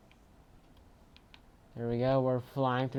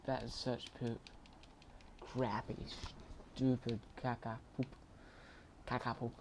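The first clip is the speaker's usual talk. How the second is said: usual speaking voice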